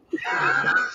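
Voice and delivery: laughing. Real, not acted. Cracking up at the memory.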